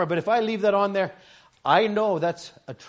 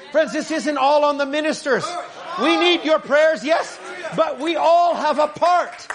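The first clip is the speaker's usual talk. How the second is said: shouted, higher, slow sermon climax; full-force preaching; 7 semitones higher, 5 dB louder, slower than usual. Acted. Preacher shouts to the congregation that everyone has a part. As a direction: shout it to the back of the church.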